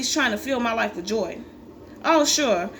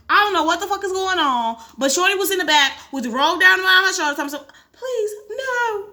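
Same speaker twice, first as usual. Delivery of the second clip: ordinary talk, nothing marked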